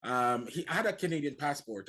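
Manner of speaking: normal talking voice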